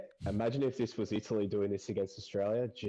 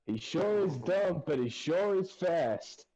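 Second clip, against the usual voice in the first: high, slow Southern drawl; film quote voice; 7 semitones higher, slower than usual. Retold or imitated. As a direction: drag it out like a movie line. Quoting a film line.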